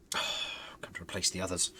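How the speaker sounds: breathy sigh, then a resentful whisper; whispered grumble. Acted. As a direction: whisper it under a sigh, put out. Ghost butler grumbles to new servant.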